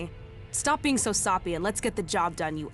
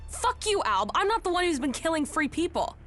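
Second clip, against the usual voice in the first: higher-pitched, offended outburst; indignant retort; 6 semitones higher than usual. Acted. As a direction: throw the accusation straight back, outraged. Quarrel, snapping back at accusation.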